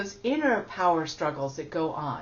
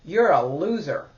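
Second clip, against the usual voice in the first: louder, cold inner critic; 6 dB louder than usual. Shown as a demonstration. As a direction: sentence yourself flatly. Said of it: "So, there I am".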